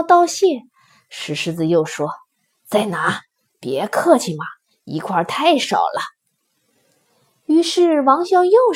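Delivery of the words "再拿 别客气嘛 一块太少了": low, coaxing lion voice; lightly voiced lion urging him on; generous stone lion. A narrator's reading. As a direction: pitch it down and coax. Lion urges him to take more.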